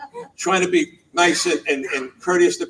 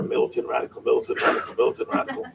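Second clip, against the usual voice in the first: mocking whisper; 5 semitones lower, 6 dB quieter, faster than usual. Mocking the warning he heard.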